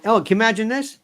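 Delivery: normal talking voice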